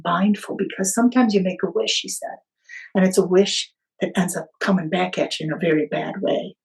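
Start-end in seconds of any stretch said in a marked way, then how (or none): none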